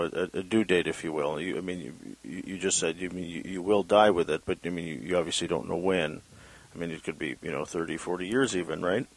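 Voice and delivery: normal talking voice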